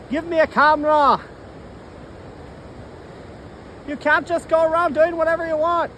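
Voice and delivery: high, bossy inner voice; scolding inner voice. Pretend. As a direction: scold yourself from the outside. Voices in his head scold him.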